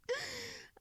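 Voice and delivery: a quiet amused giggle; soft high laugh. Acted. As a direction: laugh softly at the little human. Vampire teases the listener.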